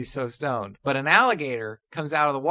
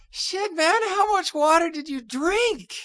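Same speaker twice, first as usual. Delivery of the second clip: very high, strained stoner koala; drawn-out high punchline voice, stretching the last word; stoned koala bear; slower than usual. Acted. Stoned koala reacting to the alligator, high strained voice. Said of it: "The koala bear goes".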